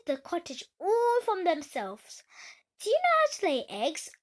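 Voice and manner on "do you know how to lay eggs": quick, higher hen voice. Acted. The hen quizzing the new duckling, quick and higher. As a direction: fire the question off fast as the hen. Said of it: "asked the hen".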